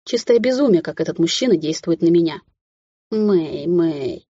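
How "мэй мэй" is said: drawn-out, aching plea; pained pleading. A narrator's reading. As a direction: plead gently through pain. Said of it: "протянул он с мольбой и болью".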